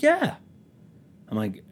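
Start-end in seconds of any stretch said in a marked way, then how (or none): 0.0-0.4 very high, loud mock yes; acted-out squeal of agreement; keen agreement. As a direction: burst out in agreement. Acting out worker's keen agreement.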